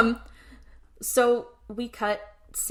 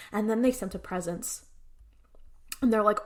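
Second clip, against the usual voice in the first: ordinary talk, nothing marked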